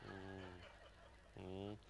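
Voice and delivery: tiny, hushed "mm"; very quiet. Retold or imitated. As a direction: imitate a friend gone very quiet, just murmuring. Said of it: "very quiet".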